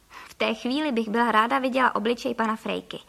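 plain reading voice

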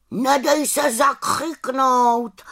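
slow, drawn-out raven's call; raven calling after her. Acted. Raven Abraxas calls farewell to the little witch, slow and urging. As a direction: call encouragement after a departing friend. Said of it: "volal za ní na rozloučenou".

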